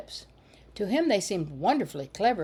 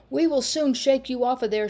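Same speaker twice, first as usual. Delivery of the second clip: put-on higher, louder angry boyish threat; shouted; 6 semitones higher, 6 dB louder than usual. A narrator's reading. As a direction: yell up at the girl on the gate. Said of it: "shouted Sam".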